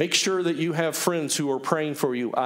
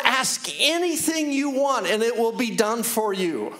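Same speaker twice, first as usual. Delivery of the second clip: emphatic preaching; 8 semitones higher than usual. Real, not acted. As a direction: preach it with raised pitch and weighty pauses. Preaches with high, emphatic pitch.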